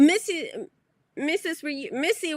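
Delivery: normal talking voice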